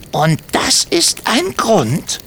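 high, slow and pointed; stern kobold question. Acted. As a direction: ask it slowly so they feel ashamed. Timmy repeats rebuke.

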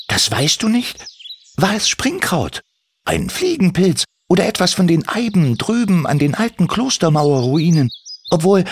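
leicht höher gesprochener Kobold, freundlich; junger Kobold. Acted. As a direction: ein kleiner, eifriger Kobold fragt nach. Timmy fragt nach. (Kobold Timmy fragt Andi, leicht höhere Stimme)

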